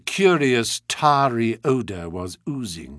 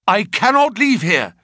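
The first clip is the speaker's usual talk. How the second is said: firm and raised; 8 semitones higher, 5 dB louder than usual. Acted. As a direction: stand his ground, insistent. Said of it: "said my client firmly".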